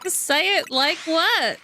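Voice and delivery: mock innocent. Real, not acted. Teased about her tone.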